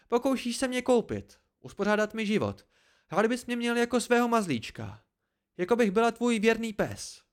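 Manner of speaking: very high, indignant tirade; sharp outburst. A narrator's reading. Dena refuses to be his pet, sharp outburst. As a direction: reject being owned, voice pitched high. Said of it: "vyhrkla ostře".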